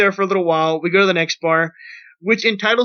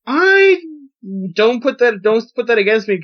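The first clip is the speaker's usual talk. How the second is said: playful protest; 4 semitones higher, 3 dB louder than usual. Real, not acted. Protesting his friend's teasing.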